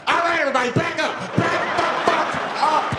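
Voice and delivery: high, loud frantic yell; flipping out. Acted. Man on the bus warning passengers off, frantic yell. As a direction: shout everyone back, losing control. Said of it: "Now the guy flips out".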